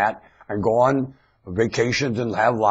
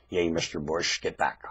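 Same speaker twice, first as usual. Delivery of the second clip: ordinary talk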